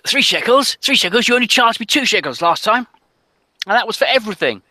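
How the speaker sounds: high, loud haggling sailor; arguing sailor. Acted. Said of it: "there's a guy arguing ... he says to".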